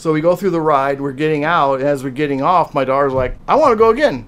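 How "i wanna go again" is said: high, loud eager burst; imitated excited child. Daughter to dad getting off the ride, imitated excitement. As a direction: mimic a thrilled kid.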